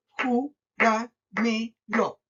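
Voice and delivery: very slow, ju, ra, me, lo with gaps; syllable by syllable. Shown as a demonstration. Teacher counting the syllables of júramelo, syllable by syllable. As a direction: chop júramelo into four separate beats. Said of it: "si yo cuento la cantidad de sílabas".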